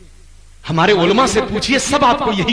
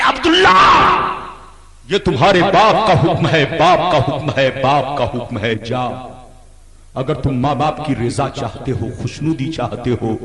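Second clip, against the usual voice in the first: gentle counsel; 10 semitones lower than usual. Acted. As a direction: gently settle the grieving son. Prophet gently speaks to son.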